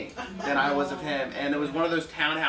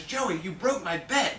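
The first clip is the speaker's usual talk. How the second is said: accusing seller; 3 semitones higher, slower than usual. Retold or imitated. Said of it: "calls me a week later and says".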